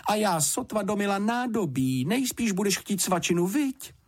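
high, flustered fussing; flustered dismay. A narrator's reading. Housekeeper caught unprepared by an arriving guest, flustered. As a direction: keep the startled pitch up. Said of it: "vyhrkla zděšeně".

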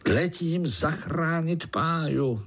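high, drawn-out heroic call. A narrator's reading. Uncle spider rushes off to rescue Pája, calling out. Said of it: "zvolal strýček Sekáč".

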